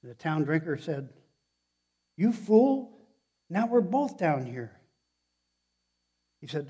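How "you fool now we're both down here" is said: loud, high-pitched mock outrage; exasperated scolding. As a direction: berate the fool who jumped in. Trapped drinker scolds his would-be rescuer.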